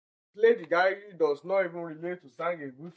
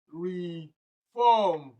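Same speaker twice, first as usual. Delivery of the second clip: "reform" split slowly, RE, then FORM; stretched stressed syllables. Shown as a demonstration. Teacher breaks word up for students, slow with second-syllable stress. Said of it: "the one that has the stress pattern".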